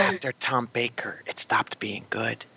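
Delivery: slow guilty whisper; worried whisper. Real, not acted. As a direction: confess it in a hush. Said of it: "Why are we whispering?".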